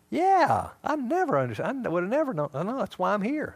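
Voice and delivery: high, loud burst of enthusiasm; eager acted realization. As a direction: play someone suddenly getting it. Acted listener eagerly reacts.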